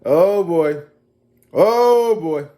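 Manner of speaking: put-on loud, high oh boy; hammed-up alarm; exclaimed oh boy. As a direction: cry it out at the drama. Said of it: "I said".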